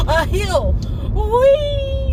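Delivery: high shrieking "whee"; thrilled squeal. Real, not acted. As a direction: squeal with delight like on a ride. Thrill of going over a hill.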